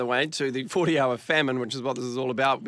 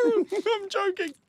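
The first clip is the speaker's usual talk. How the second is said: laughing; much slower than usual. Real, not acted. Laughing at failed attempt.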